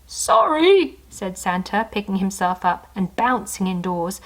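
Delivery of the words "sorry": much higher, louder sorry. Acted. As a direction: apologise cheerfully. Santa apologizes.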